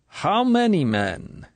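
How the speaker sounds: slow, high, prying question; cold inquisitor. A narrator's reading. Colonel interrogating the blushing girl, cold inquisitorial. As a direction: interrogate without shame. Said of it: "cold, inquisitorial tone".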